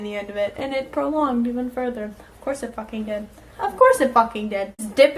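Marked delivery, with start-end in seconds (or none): 2.0-3.3 quiet resigned grumble; muttered aside. Shown as a demonstration. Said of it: "repeated line, said two ways".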